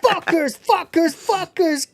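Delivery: high, loud mock scream. Little brother screaming at the couple from the cupboard. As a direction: yell it like a crazed little brother bursting out. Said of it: "who screams".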